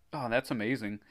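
ordinary delivery, nothing marked

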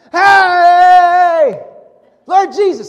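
yelling out high and loud to be heard over a crowd; loud pleading call from the roadside; desperate shout. Retold or imitated. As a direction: cry out loud to get his attention. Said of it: "they shouted all the louder".

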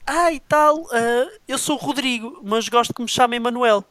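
put-on young guy voice. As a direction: imitate the young man introducing himself. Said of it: "o jovem chega e vira-se".

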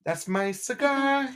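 a hint of a cocky newsboy; lightly voiced newsboy. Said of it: "start where they began with the".

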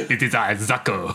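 hammy fake Mexican accent, low; faux Mexican accent. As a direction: put on a bad Mexican accent. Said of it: "a faux Mexican accent".

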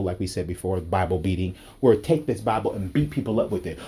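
ordinary delivery

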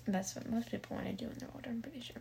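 soft, fast, voiceless whisper. Real, not acted. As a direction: whisper it as a quick aside. Hushed aside after sex talk.

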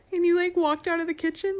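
ordinary delivery

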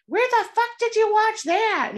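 high, loud angry parent. Retold or imitated. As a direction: yell like a furious parent. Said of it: "my parents would have been like".

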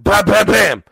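loud bam bam; loud knocking imitation. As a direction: bang out each bam.

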